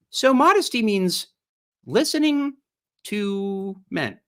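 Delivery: high, sing-song mock sweetness; mocking summary. Streamer spelling out the article's message to viewers, high and mocking.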